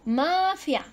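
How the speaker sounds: "Mafia" with a high, stretched má; teacher stressing MA in "Mafia"; penultimate stress. Shown as a demonstration. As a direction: lengthen and lift the first a of "Mafia".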